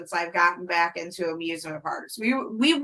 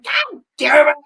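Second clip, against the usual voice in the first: louder, higher retold curse; pained outburst; 6 semitones higher, 10 dB louder than usual. Rider retelling how she hurt herself, pained outburst. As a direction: blurt it out like a sudden hurt.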